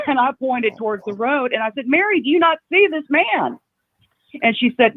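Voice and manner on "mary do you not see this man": high-pitched retold disbelief; frantic appeal. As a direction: plead with Mary to see what you see. Retold frantic appeal to unbelieving friend.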